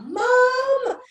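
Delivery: high, loud call of Mum; kids shouting. Retold or imitated. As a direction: shout it across the house like a child. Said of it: "the kids would shout".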